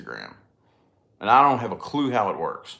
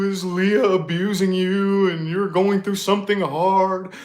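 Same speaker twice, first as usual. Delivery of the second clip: high, slow, gushing fake-sympathy voice; mock concerned follower; 8 semitones higher, 5 dB louder, slower than usual. Mocking a viewer who claims to sense the vlogger's bad energy. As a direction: ham up a nosy empath's concern. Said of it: "how many people come".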